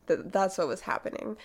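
ordinary talk

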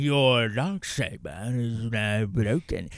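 low, slow mock villain line; hammed-up villain, each word slow; low, slow hammed-up bad guy. Villain quoted mocking the show.

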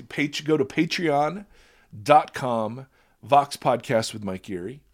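usual speaking voice

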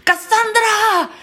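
high, drawn-out name call; imitated shouting. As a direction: wail the name like a soap-opera lover. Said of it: "Y empezó a gritar".

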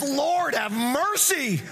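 desperate sinner begging for mercy; crying out for mercy. Retold or imitated. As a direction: cry out to God from the gut. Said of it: "the guy cries out and says".

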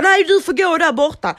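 put-on high, loud, bossy old man's bark; grumpy old man. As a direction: shout him down like a cranky old neighbour. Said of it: "Han bara". (sur gubbe som skäller högt)

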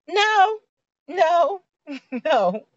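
high laughing no, no; emphatic no. Real, not acted. Vlogger laughing off giving in, emphatic no. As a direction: shut it down with a laugh. Rejecting the mind's agreement.

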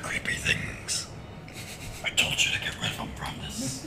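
whisper. Pretend. Player whispers in character.

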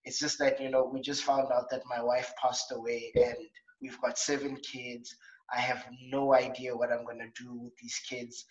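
imitating a widower pleading through tears, hushed; tearful grieving father. Widower apologising on the train, quietly tearful. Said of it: "He just starts crying and he's like".

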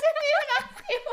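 high, loud giggly apology; laughing apology. Real, not acted. Apologizes laughing over Star Wars.

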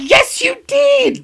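very high, slow, loud sing-song; squeaky mock insist. Teasing her co-host, high slow sing-song insistence. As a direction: drag it out in a silly high voice.

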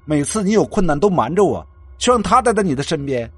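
loud, high, heated accusation; roaring jealousy. A narrator's reading. Jealous friend accuses He Yuan of loving another, roaring. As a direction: hurl the accusations, voice pushed up. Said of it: "陆明轩吼道".

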